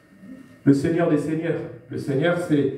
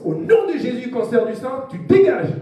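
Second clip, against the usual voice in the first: higher, louder rebuke of the illness; commanding prayer; 6 semitones higher, 3 dB louder than usual. Shown as a demonstration. Preacher and wife praying over the sick, commanding rebuke. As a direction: order the cancer out in Jesus' name.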